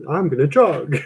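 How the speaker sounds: mock announcement that breaks into laughter; laughing mock quote. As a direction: deliver the joke line, then crack up. Quotes a silly joke, cracks up.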